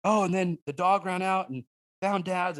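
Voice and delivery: put-on high, breathless-with-pride kid retelling; excited daughter. Daughter bragging to anyone who asks about the dove hunt, mock excited. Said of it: "You ask her about that dove hunt".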